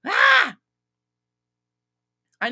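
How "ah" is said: sudden high, loud shriek; exasperated shriek. Real, not acted. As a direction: let out a frustrated yelp. Exasperated outburst.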